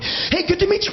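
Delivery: high-pitched, over-eager greeting; pumped up. Retold or imitated. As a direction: blurt it with crazed adrenaline. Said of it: "all pumped up".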